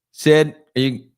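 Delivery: mock-urgent plea through the car window; loud retold pleading.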